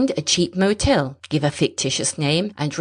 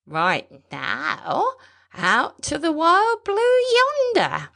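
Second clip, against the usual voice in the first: put-on old pilot sings out the last words much higher and slower; dreamy, lilting old-timer; old pilot grinning at the horizon, thin and lilting; thin high old man; 11 semitones higher, slower than usual. A narrator's reading. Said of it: "The voice was thin and high-pitched".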